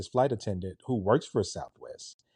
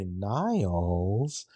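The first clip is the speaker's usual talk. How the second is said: drawn-out Flushing, New York twang; Fran Drescher. Acted. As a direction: mimic a TV nanny calling the butler. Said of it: "she sounded like Fran Drescher from The Nanny".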